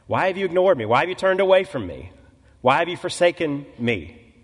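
loud, high, anguished plea; anguished cry. Retold or imitated. Jesus crying out to God from the cross, loud anguished plea. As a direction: cry it out to heaven, voice rising.

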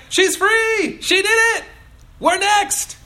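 very high, loud mock celebration; hammed-up jubilant shouting; cheering fish. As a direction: squeal it like an excited crowd of fish. Voicing fish cheering the escape.

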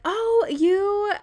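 high, loud coy "Oh, you"; flustered dismissal of a compliment; bashful brush-off. Retold or imitated. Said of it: "First thing is to downplay it".

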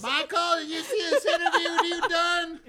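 high, loud, laughing Southern wife voice; deep South accent. Retold or imitated. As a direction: shriek it like a scandalized Southern wife. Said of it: "That's exactly what [name]'s wife sounds like".

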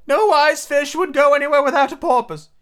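high, loud turtle voice; Mock Turtle figure. Mock Turtle advising Alice, high character voice. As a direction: declare it way up high as a storybook turtle.

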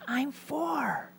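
put-on high, soft child whisper; whispering little boy. Little boy answers a telemarketer's call, whispering. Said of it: "still whispering, the little voice said".

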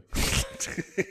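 a sudden high squealing laugh; very high squeal of laughter. Real, not acted. Cohost squealing with laughter at the grill joke.

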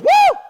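shrieked high woo of joy; excited whoop. Retold or imitated. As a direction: let out a joyful squeal. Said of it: "Elizabeth goes".

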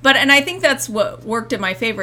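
normal talking voice

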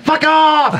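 high, punched-out "fuck off"; sudden high-pitched outburst; exclaimed kiss-off. Acted. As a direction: blurt it high as a comic rebuff. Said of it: "I said fuck off in response".

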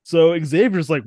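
ordinary delivery

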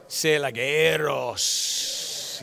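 eros with a rolled R, slowly drawn out. Shown as a demonstration. Pastor shows the congregation how to say it, rolled R. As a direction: roll the R in eros, slow. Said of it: "You gotta roll the R a little bit".

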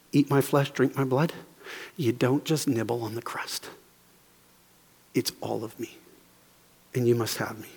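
very soft and low, with long pauses; solemn appeal. Acted. Jesus offering himself as bread of life, solemn. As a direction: let each phrase land quietly.

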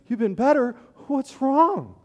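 gushing imagined concern; mock concerned friend. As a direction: play the friend who finally asks. Hoped-for concerned reply, acted.

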